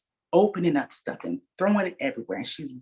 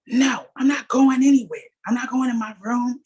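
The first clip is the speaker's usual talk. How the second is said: higher, louder defiant yell; defiant shout; 8 semitones higher, 6 dB louder than usual. Retold or imitated. Daughter refusing her mom's order, defiant shout. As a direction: shout it back, refusing to budge.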